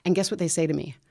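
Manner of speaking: normal talking voice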